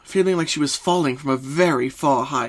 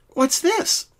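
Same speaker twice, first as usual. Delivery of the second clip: much higher, puzzled question; curious Pinky. A narrator's reading. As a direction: wonder at the strange device. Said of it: "she asked".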